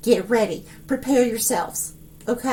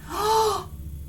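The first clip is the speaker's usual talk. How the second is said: gasp; 8 semitones higher, 5 dB quieter than usual. Real, not acted. Stunned by the vision.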